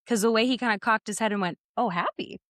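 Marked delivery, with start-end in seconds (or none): 1.8-2.4 mild pleased surprise, rising at the end; a touch of happy surprise; happily surprised. Retold or imitated. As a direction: imitate someone pleasantly taken aback. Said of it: "like, happily surprised".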